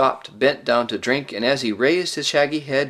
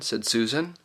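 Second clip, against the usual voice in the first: ordinary delivery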